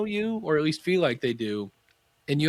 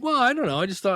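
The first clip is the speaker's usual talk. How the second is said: high, louder mock floundering; flustered client; 10 semitones higher, 4 dB louder, faster than usual. Client defending a six hundred dollar purchase, flustered. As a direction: imitate a client fumbling for an excuse, pitched up.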